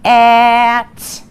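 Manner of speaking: "At" stretched slow and loud, sound by sound; sounded-out word. Shown as a demonstration. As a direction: segment "At" for the children. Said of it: "say the sounds in the hat using our roller coaster".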